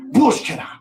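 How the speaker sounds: loud, high one-word shout; sharp dog command. Shown as a demonstration. Streamer demonstrates word that stops dogs, shouted command. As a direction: shout it to stop a dog dead. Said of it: "just remember this word".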